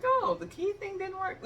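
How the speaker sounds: high, mocking imitation of the husband. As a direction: tease him with his own line. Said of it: "he was like".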